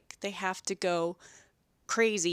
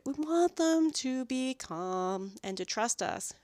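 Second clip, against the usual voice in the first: normal talking voice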